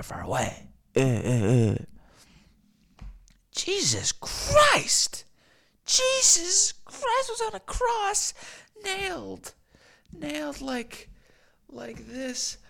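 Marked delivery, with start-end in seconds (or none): 3.5-12.6 very high, slow, strained voice, fading to a whisper; pained falsetto sinking to a whisper; anguished Jesus voice. Acted. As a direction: play Jesus on the cross, voice cracking high. Acts out crucified Jesus.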